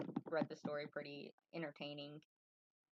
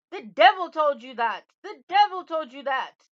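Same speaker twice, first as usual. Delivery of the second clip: high, very loud outraged shout; furious yell; 11 semitones higher, 22 dB louder than usual. Acted. Little man hears his name guessed, furious yelling. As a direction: explode in fury, hitting DEVIL hard. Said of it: "the little man yelled".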